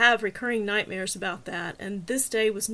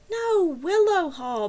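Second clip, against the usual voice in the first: slow, louder, higher, Willow Hall pressed hard; insistent correction; 8 semitones higher, 6 dB louder, slower than usual. A narrator's reading. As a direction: spell out Willow Hall firmly. Correcting the dispatcher, frustrated.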